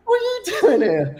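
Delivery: high surprised question; surprised parents. Retold or imitated. Parents surprised to see their son at night, high-pitched. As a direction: act out the parents' amazement. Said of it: "They, they".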